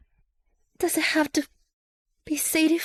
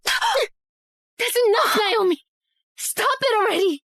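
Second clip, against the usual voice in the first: slow, high furious shout; 5 semitones higher, slower than usual. Acted. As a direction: yell to stop her cold. Stopping a quarrel by shouting.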